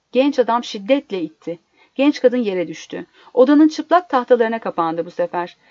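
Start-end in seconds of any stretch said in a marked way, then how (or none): none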